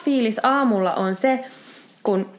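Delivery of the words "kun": usual speaking voice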